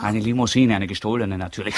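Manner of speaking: usual speaking voice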